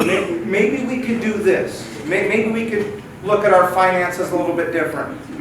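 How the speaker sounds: normal talking voice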